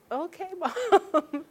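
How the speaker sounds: high, drawn-out, laughing okay; humouring Mom. Retold or imitated. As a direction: indulge her with a slow, lilting laugh. Humours mother's grand claim.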